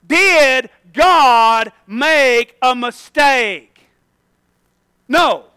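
slow, drawn-out preacher's challenge; forceful sermon question and answer; emphatic preaching. Real, not acted. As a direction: stretch each word out for the congregation.

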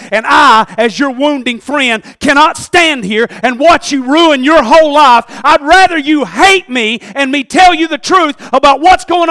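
thundering pulpit climax; full-force preaching. Acted. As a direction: build to a shouted warning. Preacher rebukes David as friend.

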